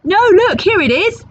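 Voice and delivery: high, loud excited cry. Acted. Peg spots the chest, high excited cry. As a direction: squeal it out with glee. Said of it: "cried Peg Polkadot".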